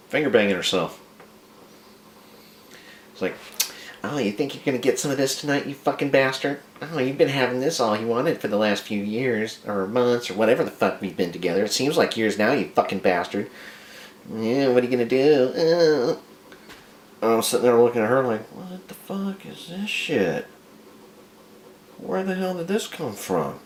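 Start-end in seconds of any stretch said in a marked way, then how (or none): 4.0-16.2 higher-pitched imitation of a taunting wife; wife's mock taunting. Wife taunting her new husband on wedding night, mock nagging. 18.5-23.6 very high, drawn-out bewildered voice; stunned disbelief. Retold or imitated. Husband staring at his new wife, acted high-pitched disbelief.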